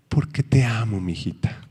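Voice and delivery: put-on God reassuring his child, ending in a whisper; tender God. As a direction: whisper it like a loving father.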